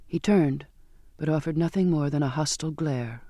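usual speaking voice

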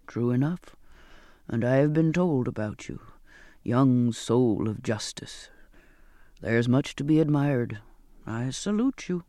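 put-on low, measured old sailor; Zachariah's admiring voice. Zachariah salutes Charlotte for her justice, low admiring voice. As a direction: speak low, lingering on the praise.

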